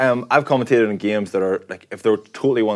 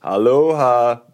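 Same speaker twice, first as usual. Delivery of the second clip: drawn-out greeting; 2 semitones higher, 5 dB louder, much slower than usual. Retold or imitated. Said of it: "if you say".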